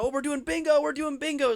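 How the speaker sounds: high, bright mock excitement; mock excited. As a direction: chirp it like an eager announcement. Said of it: "excited about".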